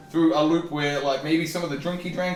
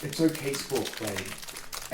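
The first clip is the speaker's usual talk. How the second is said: slow, soft reassurance; calm and soothing; 3 semitones lower, 7 dB quieter, much slower than usual. Acted. Player calming the frightened Sporkblade, soft and slow. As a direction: ease him down gently, very slowly. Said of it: "in a calm and soothing voice".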